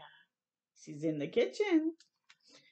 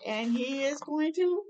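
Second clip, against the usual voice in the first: plain reading voice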